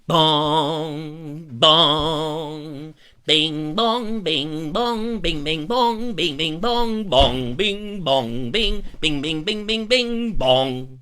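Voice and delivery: sing-song mock bell peal; imitated bell ringing. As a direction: chime out the bells like a peal. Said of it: "Roger going in there and going".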